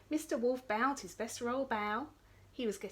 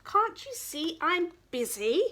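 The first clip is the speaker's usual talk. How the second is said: high, loud irritated shout; a lazy princess yells; 8 semitones higher, 6 dB louder than usual. Acted. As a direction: bark it out, annoyed. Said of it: "shouted the princess".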